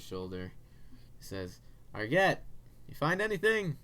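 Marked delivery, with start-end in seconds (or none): 1.9-3.8 calling out. Acted. NPC calls to the back room.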